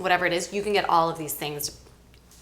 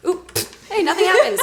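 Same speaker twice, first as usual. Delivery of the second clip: laughing; 11 semitones higher, 7 dB louder than usual. Real, not acted. Laughs at surprise result.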